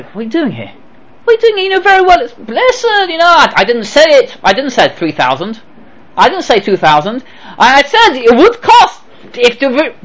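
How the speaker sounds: high, panicky denial; flustered protest. Retold or imitated. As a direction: stammer out an excuse. Caught electrician protests.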